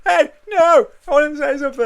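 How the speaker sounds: high, loud shout of objection; hammed-up indignant call; mock protest. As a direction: imitate a winner yelling over the music. Acts winner cut off by music.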